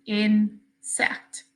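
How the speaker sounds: "insect" drawn out syllable by syllable; slowed down. Shown as a demonstration. Teacher helping pupils count syllables, slowed right down. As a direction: say "insect" slowly, pausing between in and sect. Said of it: "Slow it down if you need to".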